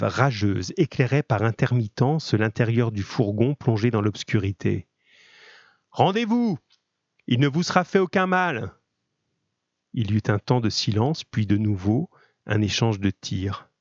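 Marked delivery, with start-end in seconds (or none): 5.9-8.7 calling out. Acted. Attackers call out to gendarmes.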